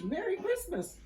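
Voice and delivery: high, bright call of Merry Christmas; joyful greeting. A narrator's reading. Narrator voicing crowds calling out a cheerful greeting. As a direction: sing out the greeting to the street.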